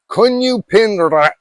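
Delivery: "Kunyu Pin Ra" said slowly and high, with a strong rolled R on "Ra"; slow Thai phrase with a rolled R. Shown as a demonstration. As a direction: drag out "Kunyu Pin Ra" and trill the R on "Ra". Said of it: "they roll the R".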